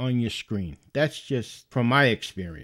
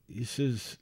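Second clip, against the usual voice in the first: ordinary talk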